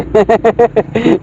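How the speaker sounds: light playful laugh; teasing chuckle. Real, not acted. Adult amused by a crying child's runny nose, teasing chuckle. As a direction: chuckle to cheer someone up.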